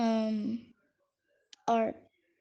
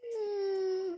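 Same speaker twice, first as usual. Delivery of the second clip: high whimper; 8 semitones higher, 3 dB quieter than usual. Pretend. Fake whimper.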